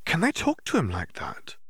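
a hint of a puzzled girl; lightly voiced Hermione. A narrator's reading. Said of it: "Hermione wondered aloud".

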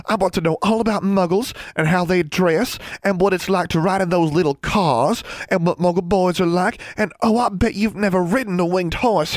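high, bubbly excited chatter; gushing older sister. Acted. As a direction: gush on in a girlish rush of questions.

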